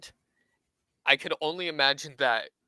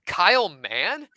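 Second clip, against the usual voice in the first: slow, raised, louder incredulous question; retold disbelief; 5 semitones higher, 5 dB louder, slower than usual. Man hearing who the mutual friend is, incredulous. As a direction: draw out the name in mock disbelief.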